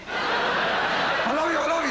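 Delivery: high, slow breathy dog voice; a dog humping a leg, drawn out; amorous dog. Retold or imitated. Comic as a dog humping his leg, slow high breathy voice. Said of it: "a dog confuses my leg".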